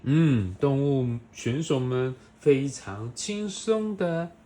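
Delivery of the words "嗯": usual speaking voice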